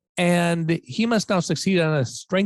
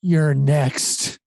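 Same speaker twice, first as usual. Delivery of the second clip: mild mock menace; slow-burn threat, lightly acted; menacing threat. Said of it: "eyes blood red say".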